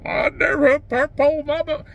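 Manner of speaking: mock the stubborn old-timer lightly. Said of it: "I remember when power poles come out".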